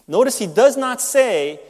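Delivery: sharp emphatic denial; stress on NOT. Shown as a demonstration. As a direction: hit "does" and "not" hard. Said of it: "contrast emphasis".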